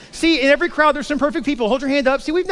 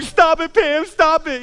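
high-pitched mock plea to a sister; pleading child; 6 semitones higher, slower than usual. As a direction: beg her in a higher kid's voice.

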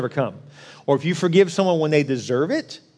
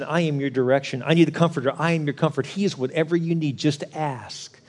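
ordinary delivery

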